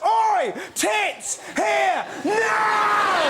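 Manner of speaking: high, barked one-word orders; demanding shout. Acted. Phone translating a rude baby's cry, high demanding shout. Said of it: "The iPhone goes".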